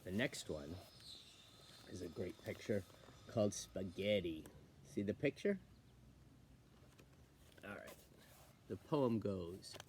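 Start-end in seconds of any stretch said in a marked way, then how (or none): none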